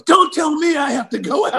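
high-pitched mock yell with laughter; indignant retort. Bloodied player refusing his teammate's advice to go out, mock outrage.

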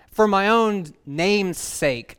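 slow and loud, every word weighted; emphatic proclamation. Acted. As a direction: declare it slowly like a pulpit verdict. Proclaiming God's words.

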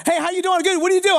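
bubbly like a jackrabbit; chirpy greeting. Retold or imitated. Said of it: "all of a sudden, it's like".

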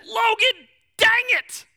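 loud, high, frustrated shout; exasperated yell. Retold or imitated. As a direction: snap at the toddler who spilled the pop. Scolding daughter after spill.

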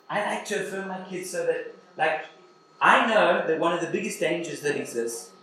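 ordinary talk

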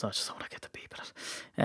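breathy whisper thrown in as an aside. Real, not acted. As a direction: drop to a whisper for the confession. Aside about swear words.

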